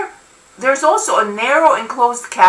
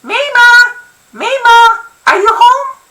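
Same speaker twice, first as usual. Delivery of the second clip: put-on high, loud boy calling out; excited kid shouting through the house; boyish urgent call; 12 semitones higher, 6 dB louder than usual. A narrator's reading. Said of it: "Uru's boyish voice calls out".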